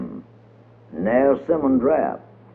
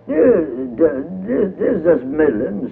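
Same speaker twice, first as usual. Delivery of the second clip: Miss Cow voice; 5 semitones higher, slower than usual. A narrator's reading. Miss Cow answering, higher.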